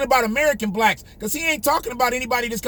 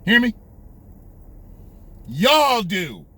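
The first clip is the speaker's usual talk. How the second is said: punchy mock of a condescending politician; Joe's contempt; slower than usual. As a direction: imitate him talking down, hard on each phrase. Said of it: "this is how he talked to you".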